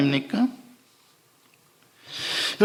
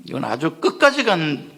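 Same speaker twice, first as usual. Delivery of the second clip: usual speaking voice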